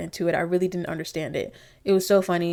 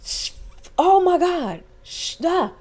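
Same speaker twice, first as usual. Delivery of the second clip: mock shock; 10 semitones higher, 4 dB louder, much slower than usual. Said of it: "I would have been at the table like".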